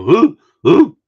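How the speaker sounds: loud, high mock baying of a hound; basset hound baying. Imitating the keeper's noisy dog baying loudly. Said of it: "like a freaking basset hound".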